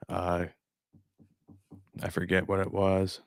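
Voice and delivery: ordinary delivery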